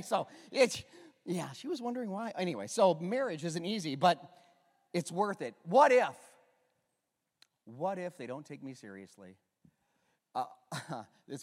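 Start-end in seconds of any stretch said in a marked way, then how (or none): none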